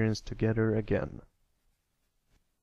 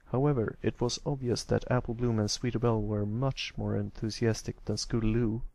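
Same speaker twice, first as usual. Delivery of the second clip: ordinary delivery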